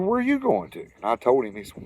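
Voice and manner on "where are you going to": friendly stranger. Retold or imitated.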